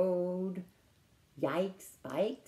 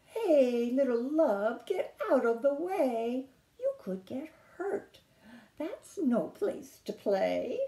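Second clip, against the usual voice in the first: mama's voice; 6 semitones higher, 5 dB louder than usual. Acted. Mama toad voiced in story.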